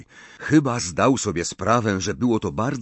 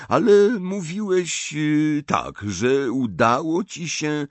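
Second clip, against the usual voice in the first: raised, unhurried, puzzled elder; higher-pitched, leisurely questioning; curious old headmaster; 6 semitones higher than usual. A narrator's reading. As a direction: marvel politely and ask for an explanation. Narrator voices Dumbledore.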